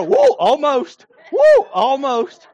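slow, loud, laughing taunt; teasing laugh. Retold or imitated. The fisherman teasing people who miss the money, laughing slowly. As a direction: tease them through a laugh, slowly. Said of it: "And he go".

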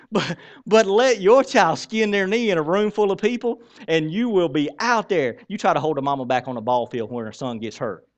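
normal talking voice

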